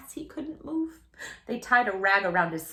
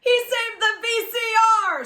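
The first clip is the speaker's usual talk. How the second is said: high, loud, thrilled shout; delighted father; 13 semitones higher, 8 dB louder than usual. A narrator's reading. Father cheering loudly that the VCR was saved. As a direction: cheer the rescue. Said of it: "said Father".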